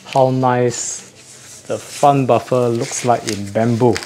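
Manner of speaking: ordinary talk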